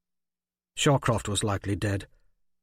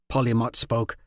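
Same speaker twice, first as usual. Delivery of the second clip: ordinary delivery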